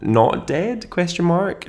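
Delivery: high and rising, mocking doubt; rising joke. Real, not acted. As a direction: lift it up into a question. Joking about chivalry.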